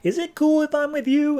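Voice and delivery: put-on hopeful kid asking to join, high and sheepish; hopeful Superboy. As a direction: ask sheepishly, voice jumping up high. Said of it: "Superboy's like".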